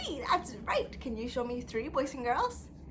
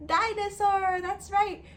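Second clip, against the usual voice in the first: excited; 9 semitones higher, 5 dB louder than usual. Acted. Big reveal to children.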